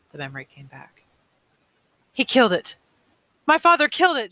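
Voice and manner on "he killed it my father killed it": much higher, louder stunned cry; shocked outcry. Acted. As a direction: blurt it out in horror. Shocked realization.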